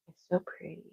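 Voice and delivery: ordinary talk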